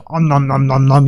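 monster munching noises; hammed-up cartoon gobble; mock gobbling. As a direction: chomp through it playfully. Playfully imitates gobbling a head.

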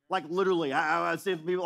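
ordinary talk, nothing marked

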